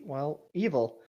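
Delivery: ordinary talk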